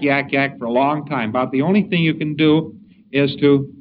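ordinary delivery

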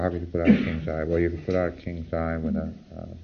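quiet, low monotone of a preclear barely answering; apathetic grinding PC. Shown as a demonstration. Lecturer imitating a grinding PC, quiet and low. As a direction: drone it like a client who has checked out. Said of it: "they don't even have to run in that tone of voice".

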